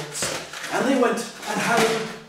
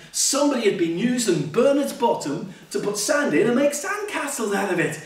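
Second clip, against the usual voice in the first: plain reading voice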